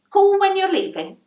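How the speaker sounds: landlady calling up the stairwell. Acted. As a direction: shout it after her as she goes.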